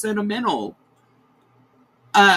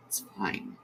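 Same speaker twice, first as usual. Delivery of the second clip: "fine" breathed out as a soft whisper; choked-up whisper to steady herself; 3 semitones higher, 13 dB quieter than usual. Real, not acted. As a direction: whisper "It's fine" to yourself, no voice.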